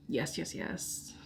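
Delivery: breathy whispered yes, slow and soft; hushed, slow whisper of delight. Real, not acted. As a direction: whisper it to yourself, savouring it. Gleeful whispered agreement to herself.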